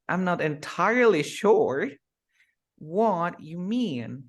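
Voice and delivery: slow, rising "I'm not entirely sure what you mean"; rhythm and stress. Shown as a demonstration. Teacher shows native rhythm, slow and rising. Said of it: "te diste cuenta cómo cambia".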